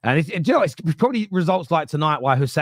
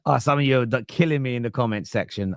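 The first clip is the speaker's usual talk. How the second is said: ordinary talk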